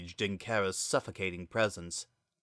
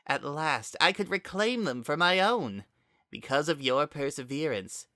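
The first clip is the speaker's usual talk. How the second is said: higher, eerie possessed voice speaking through Azriel; a dark spirit wearing a friend's voice, higher; possessed Kara voice; 6 semitones higher than usual. A narrator's reading. Kara confronts Frisk, speaking as eerie higher voice.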